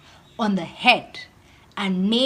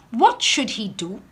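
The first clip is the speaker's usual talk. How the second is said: ordinary delivery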